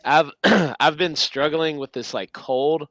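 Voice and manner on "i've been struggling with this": ordinary delivery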